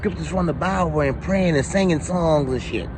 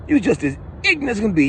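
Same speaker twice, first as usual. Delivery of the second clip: ordinary talk, nothing marked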